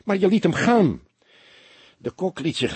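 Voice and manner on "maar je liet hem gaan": higher, louder indignant outburst; worked-up protest. Acted. Young detective, growing angry, confronting his calm boss. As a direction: push back hard, getting more and more upset. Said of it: "Fledder wond zich steeds meer op".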